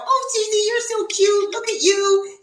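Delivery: high cooing voice; baby-talk the cute cat. Retold or imitated. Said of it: "because I go".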